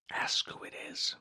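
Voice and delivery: unvoiced whisper, barely a breath; mouthed whisper. A narrator's reading. Simon Peter secretly mouthing to the disciple, whispered. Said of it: "mouthed to him".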